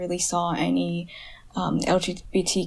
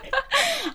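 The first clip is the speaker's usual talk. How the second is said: squealing laugh, very high; a high-pitched burst of laughter. Real, not acted. Laughing at her own trick question to her sister.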